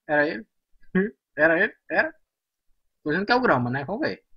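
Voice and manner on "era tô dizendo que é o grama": usual speaking voice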